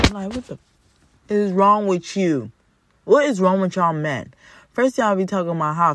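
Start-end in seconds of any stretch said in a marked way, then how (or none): 0.3-4.3 outraged. Retold or imitated. Answering the creepy guy, loud outraged rant. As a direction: blast him for it.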